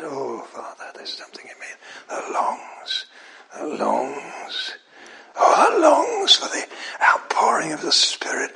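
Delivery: whispered, breaking prayer of longing. Acted. Believer whispering a longing prayer to God. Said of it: "but you've got that".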